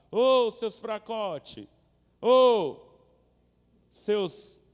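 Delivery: loud, high, slow mocking bellow; taunting giant. Goliath taunting the Israelites, loud slow mocking bellow. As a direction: jeer at them like a giant bully.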